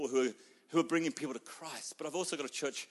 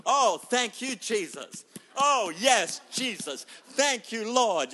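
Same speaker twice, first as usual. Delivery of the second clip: loud, high praising cry, Jesus and Lord dropping back; mock church praise; 5 semitones higher, 7 dB louder, slower than usual. Pastor voicing the whole church, loud mock praise. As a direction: ham up the congregation's joy.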